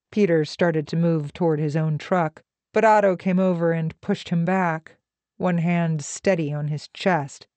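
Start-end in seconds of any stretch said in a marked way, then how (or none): none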